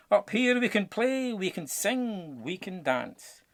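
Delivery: high, bright encouragement; joyful haggis. A narrator's reading. Haggis cheers on the climbers, joyful. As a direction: coax them upward with delight. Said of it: "cried the haggis".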